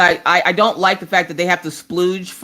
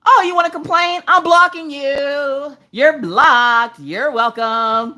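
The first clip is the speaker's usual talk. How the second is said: sassy mod voice; 8 semitones higher, slower than usual. Pretend. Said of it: "certain mods would be like".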